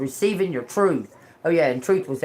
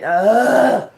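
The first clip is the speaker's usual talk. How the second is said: exasperated groan; 5 semitones higher, 8 dB louder than usual. Retold or imitated. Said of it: "I went".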